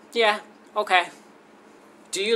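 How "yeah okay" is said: casual acceptance. Retold or imitated. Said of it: "We were like".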